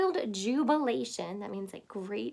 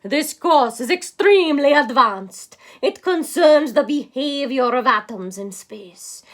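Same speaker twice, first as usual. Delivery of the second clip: loud, commanding lecturer's announcement; booming professor; 10 dB louder than usual. Acted. A professor warning the class, loud and grand. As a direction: boom it out like a stern professor.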